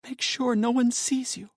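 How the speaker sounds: wife giving hushed directions; wife urging. Acted. Wife's voice voiced by narrator.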